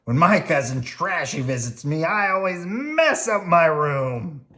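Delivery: gruff grouchy Oscar; grumpy garbage-can grouch. Acted. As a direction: growl it like a grouch. Oscar voiced.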